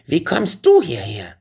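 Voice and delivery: high, loud witch outburst; furious witch. A narrator's reading. Witch startled by Benjamin's arrival, furious and high. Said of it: "stampfte erneut wütend mit den Füßen auf".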